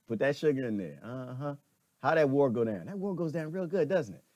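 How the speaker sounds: ordinary delivery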